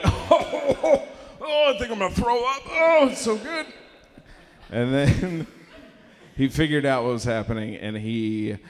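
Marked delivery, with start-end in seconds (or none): 0.0-3.7 high, helpless mock hysterics; imitated hard laughter. Kids laughing at tricked dad, acting hysterics. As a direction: squeal it through acted laughter. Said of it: "we started laughing so hard".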